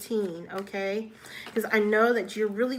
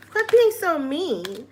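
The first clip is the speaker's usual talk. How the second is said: higher, louder teasing scold; mock-stern, louder and higher; playful scolding; 6 semitones higher, 4 dB louder than usual. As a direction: tell them off like a fond friend. Playfully scolding viewers for self-criticism.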